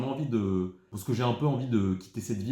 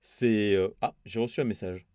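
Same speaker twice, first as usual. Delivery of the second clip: ordinary delivery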